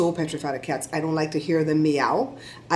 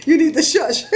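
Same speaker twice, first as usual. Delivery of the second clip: higher, louder, laughing scold; teasing laugh; 9 semitones higher, 3 dB louder than usual. Real, not acted. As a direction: playfully hush her between laughs. Teasing friend [name].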